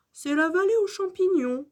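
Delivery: high-pitched guide's explanation; explication de Monsieur Fossile. A narrator's reading. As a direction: explain it to wide-eyed pupils. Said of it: "explique Monsieur Fossile". (explication de guide, voix haute)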